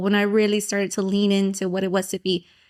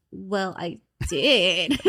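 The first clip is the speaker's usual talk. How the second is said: deadpan retort; much slower than usual. Retold or imitated. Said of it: "And it's like".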